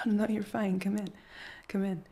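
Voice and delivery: embarrassed startled welcome. Real, not acted. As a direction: caught off guard, wave them in. Caught rehearsing, partner arrives.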